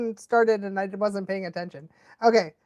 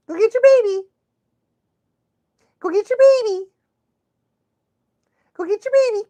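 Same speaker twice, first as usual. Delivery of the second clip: high, loud singsong to the dog; high excited dog-talk; 11 semitones higher, 5 dB louder, faster than usual. Real, not acted. Owner urging her dog to fetch its toy, high excited singsong.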